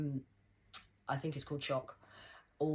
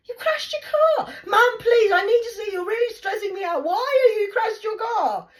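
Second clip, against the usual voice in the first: very high, loud mock wail; mimicked distraught daughter; 15 dB louder than usual. Imitating daughter hearing of the crash, high hammed-up shriek.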